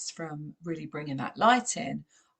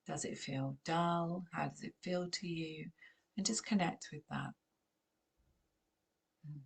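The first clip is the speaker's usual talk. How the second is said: soft, hushed meditation voice, drifting to near whisper; gentle guided meditation, softer and softer; 6 dB quieter than usual. Acted.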